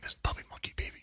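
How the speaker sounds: whispered creepy ad chant; hushed mock ad chant; whispered catchphrase. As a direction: breathe it like a spooky secret.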